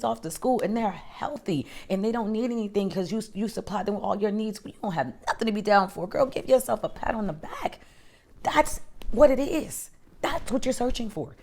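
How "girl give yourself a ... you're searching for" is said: emphatic. Real, not acted. Pep talk climax to viewer.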